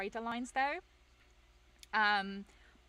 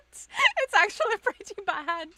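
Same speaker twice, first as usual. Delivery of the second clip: high, loud, slow hammed-up wail; mock loud admission; 10 semitones higher, 11 dB louder, slower than usual. Maker admitting the model's flaws, mock loud wail. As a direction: overplay the confession for laughs. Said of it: "And then you're just like".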